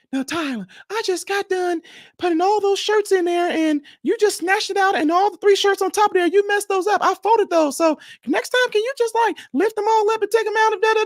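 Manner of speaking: sweet wife voice. Retold or imitated. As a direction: gently coax him like a sweet patient wife. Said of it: "my wife voice is sweet".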